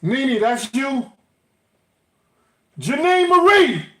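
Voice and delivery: calling names loudly through the house; yelling across the room. Real, not acted. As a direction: shout up the stairs to find someone.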